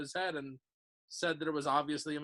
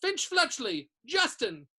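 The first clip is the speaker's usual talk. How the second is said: calling out names; 7 semitones higher, 4 dB louder, slower than usual. A narrator's reading.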